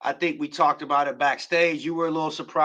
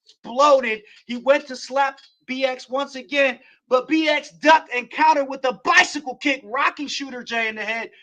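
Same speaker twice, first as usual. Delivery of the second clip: excited wrestling play-by-play; play-by-play call; 5 semitones higher than usual. Acted. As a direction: call the match like a ringside announcer.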